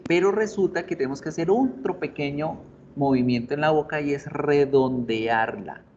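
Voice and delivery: ordinary talk, nothing marked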